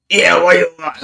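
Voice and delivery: imitated hard challenge. Anthony challenging a stranger who came asking, hard. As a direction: throw it out like a Brooklyn hard man. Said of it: "So the way he said that to me".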